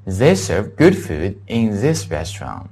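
slow, clearly spaced English model sentence; measured teacher's read-aloud. Shown as a demonstration. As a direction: read the whole sentence slowly and carefully. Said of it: "好，再读一遍".

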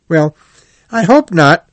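high, loud cheery retort; cheerful. A narrator's reading. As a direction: answer brightly, pitch well up. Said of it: "said Rat cheerfully".

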